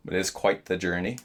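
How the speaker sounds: normal talking voice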